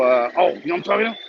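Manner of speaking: ordinary talk, nothing marked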